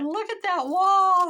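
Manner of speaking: high, slow, loud cry of amazement; retold loud exclamation. To daughter-in-law from the floor, loud retold exclamation. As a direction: exclaim it big, drawing out the words.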